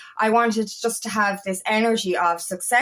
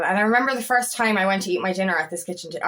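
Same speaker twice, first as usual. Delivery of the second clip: normal talking voice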